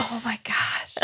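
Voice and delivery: breathless, flattered whisper. Real, not acted. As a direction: whisper it, touched and embarrassed.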